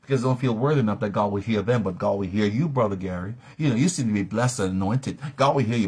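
ordinary talk, nothing marked

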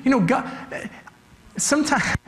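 usual speaking voice

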